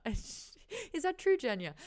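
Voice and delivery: high, slow hopeful question; imitating David. David asks Genya, slow and high-pitched. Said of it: "David and Genya scene".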